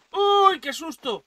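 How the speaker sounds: high, loud, drawn-out shriek; real fright, shrieked at the top; startled scream. Real, not acted. Player startled by sudden danger, startled scream. Jump scare, names the fright.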